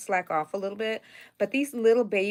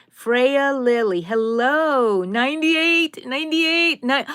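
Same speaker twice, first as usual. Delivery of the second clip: louder, higher, slow excited counting; 5 semitones higher, 9 dB louder, slower than usual. Real, not acted. Greeting viewers and counting likes, excited and loud. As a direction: call out the like count eagerly.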